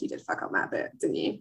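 breathy, hushed mock accusation; half-whispered teasing jab; whispered aside. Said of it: "I'm like".

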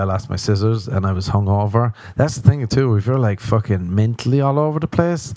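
ordinary talk

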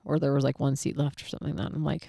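ordinary delivery

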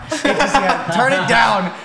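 joking back at the mic complaint; real laughing shout. Real, not acted. Answering the cohost's levels joke with a laughing shout. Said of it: "turn me down".

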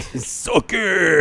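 hammed-up wrestling taunt; wrestler catchphrase. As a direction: sneer it like a heel wrestler. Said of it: "The Rock was like".

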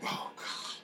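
breathless whisper of dread. Retold or imitated. Lights about to go out again, whispered dread. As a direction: breathe it out in fear.

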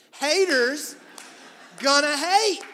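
loud, high, spaced-out proclamation; emphatic shout. Real, not acted. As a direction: belt it out with a break between words. Emphatic repeat of [name] quote.

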